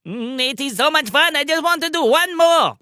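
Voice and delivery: high whiny voice begging; pleading high cartoon voice. Pim Pim begging.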